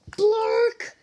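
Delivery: loud, high nonsense word; loud silly word. Acted. Reads silly word loudly.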